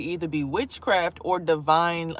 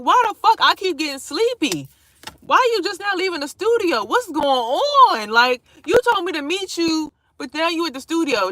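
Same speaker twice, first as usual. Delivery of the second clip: higher, louder, exasperated questioning; frustrated outburst; 9 semitones higher, 6 dB louder than usual. Retold or imitated. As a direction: demand answers, fed up. Angry at the late friend.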